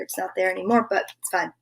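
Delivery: usual speaking voice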